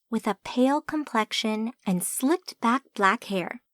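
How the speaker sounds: ordinary delivery, nothing marked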